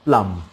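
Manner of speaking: loud, emphatic प्लम ending on m; firm प्लम, silent b; correct pronunciation. Shown as a demonstration. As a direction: punch out प्लम with no b. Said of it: "क्या बोलते हैं?".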